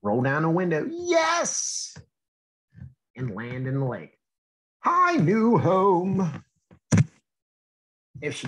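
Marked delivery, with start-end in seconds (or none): 0.9-2.0 much higher cartoon cheer; a little victory squeal; happy yes. Drop rolls down window. 4.8-6.4 slow, higher, louder drop voice; cheerful greeting. Acted.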